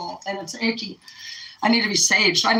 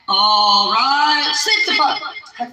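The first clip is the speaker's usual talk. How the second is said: slow, higher, dragged-out "sit the fuck"; drawn-out mock order; 3 semitones higher, 4 dB louder, much slower than usual. Vlogger playing the boss, slowly drawing out an order. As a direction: stretch the order out playfully. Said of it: "slowed mock order".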